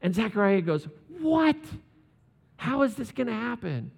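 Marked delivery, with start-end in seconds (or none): 1.2-3.9 high, disbelieving squawk, half laughing; mock disbelief at the angel; incredulous what. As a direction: play the stunned old priest. Zechariah doubting the angel.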